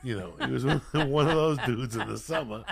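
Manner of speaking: loud laugh breaking into the words. Real, not acted. Recalling the drunk neighbor to friends, laughing loudly. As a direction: crack up mid-sentence.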